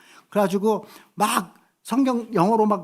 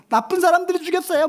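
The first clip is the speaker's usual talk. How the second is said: imitated cornered child; 13 semitones higher, 3 dB louder than usual. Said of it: "애가 막 이제 울먹이고".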